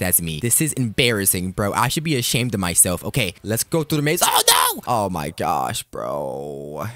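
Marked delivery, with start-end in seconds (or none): 4.2-4.8 very high-pitched cry of alarm; hammed-up shriek of dismay; panicked scream. Stuck in the maze. 4.9-6.9 low, sinking exasperation; defeated low sigh of words; deflated groan. Pretend. Dismay after being caught.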